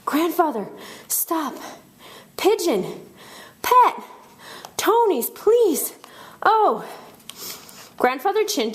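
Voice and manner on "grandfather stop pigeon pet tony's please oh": high, panting single-word bursts; out of breath. A narrator's reading. Breathless kids beg grandfather after the stairs, high gasped pleas. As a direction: gasp out each word separately, pitched high. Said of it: "we were all out of breath".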